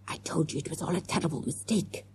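hushed, whispery hiss. A narrator's reading. Miss Parker turning to the bearded man, secretive hiss. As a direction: hiss it under your breath. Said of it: "she hissed".

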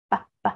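"P, p" as two separate short sounds; final p isolated. Shown as a demonstration. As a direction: sound out "P, p" on its own, twice. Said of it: "có âm cuối là p".

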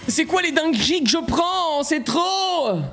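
high, slow gamer rage; outraged wailing. Real, not acted. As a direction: wail that it is all too much. Joueur excédé après sa défaite. (plainte aiguë et indignée)

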